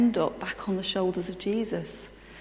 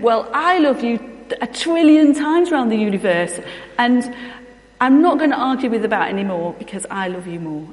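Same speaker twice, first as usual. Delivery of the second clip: much louder and higher, fast and insistent; a child shouting the last word before storming out; daughter's loud final word; 4 semitones higher, 10 dB louder than usual. Retold or imitated. Daughter ending the love argument with her mother, loud insistent shout.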